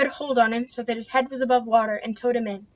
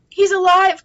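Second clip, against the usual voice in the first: high joyful cry; 8 semitones higher, 11 dB louder than usual. A narrator's reading. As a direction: cry it out in relief. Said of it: "cried the woman".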